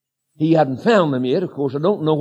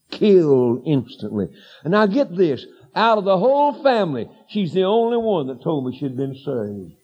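usual speaking voice

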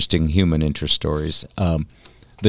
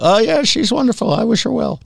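much higher, breezy mock Clinton; mock Clinton, all charm; Bill Clinton impression. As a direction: play it innocent like Clinton. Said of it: "Ask Bill Clinton about Ghislaine Maxwell".

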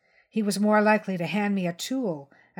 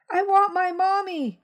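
put-on high, louder childish wail; a frightened child breaking down for his mother; hysterical little boy; 12 semitones higher, 6 dB louder than usual. Scared boy cries out when he hears his mother, high tearful wail. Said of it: "becoming hysterical".

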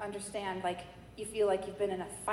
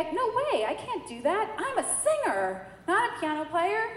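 protesting; 8 semitones higher than usual. Retold or imitated. Retold refusal, high excited.

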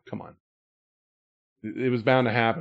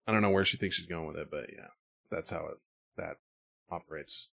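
ordinary talk, nothing marked

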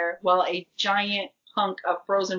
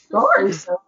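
voice crack; 12 semitones higher, 5 dB louder than usual. Real, not acted. Voice cracks while sick.

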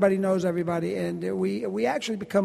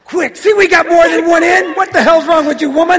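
high, very loud comic tirade; mock scolding shout; 9 semitones higher, 14 dB louder than usual. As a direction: holler impatiently in mock outrage. Mock scolding while rushing the fans.

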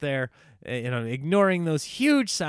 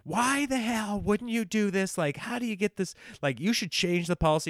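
high-pitched angry yell; yelling over the phone; 9 semitones higher than usual. Retold or imitated. Caller yelling at the tow truck owner, high angry yell. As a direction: shout your frustration at him down the line. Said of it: "I was yelling over the phone".

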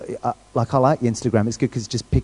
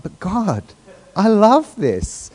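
drawn-out, higher pleading on love; mock plea; 6 semitones higher, much slower than usual. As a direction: beg God, stretching I love this slowly. Pleading with God over apartment.

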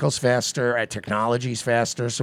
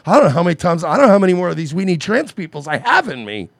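fast, louder, exasperated put-on whine; mock weary whine; 4 semitones higher, 5 dB louder, faster than usual. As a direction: voice her as worn out and fed up. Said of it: "see, she's starting already".

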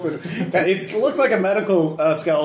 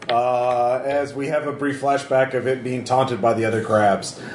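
normal talking voice

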